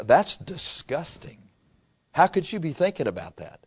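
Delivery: softly appalled, voice dropped; hushed mock disapproval; disgusted. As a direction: imitate a quietly disgusted friend. Imagined friend's disgusted reaction.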